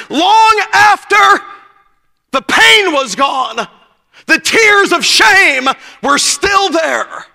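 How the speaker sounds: slow, drawn-out, weighty lines; slow preacher cadence; slow preaching emphasis. Real, not acted. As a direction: lean on each word slowly.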